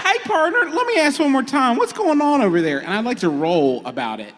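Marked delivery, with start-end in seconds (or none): 0.0-2.8 high, folksy prospector voice; wild prospector. Acted. Crewman asking one more time what's going on, wild prospector voice. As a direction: press him like a wild prospector. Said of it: "we decided on Wild Prospector".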